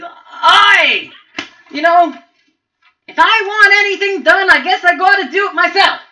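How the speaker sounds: put-on high, loud Italian mobster accent. Goblin boss scolding his minions, loud Italian mobster accent. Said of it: "he's like an Italian mobster".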